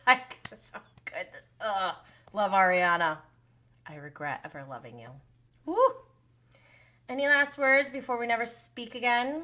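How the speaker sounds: ordinary talk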